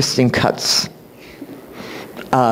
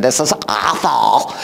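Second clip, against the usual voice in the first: slow, drawn-out moaning complaint; imitating a groan; 3 semitones lower, slower than usual.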